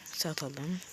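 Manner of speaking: soft unvoiced whisper; quiet muttered aside. Real, not acted. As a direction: breathe it to yourself.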